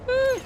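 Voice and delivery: high startled cry; sharp yelp. Real, not acted. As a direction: yelp as if caught off guard. Sudden yelp in firefight.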